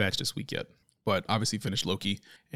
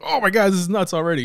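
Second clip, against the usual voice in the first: higher and louder amazed exclamation; excited; 9 semitones higher, 7 dB louder than usual. Retold or imitated. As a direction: blurt it out in shock. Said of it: "I was like".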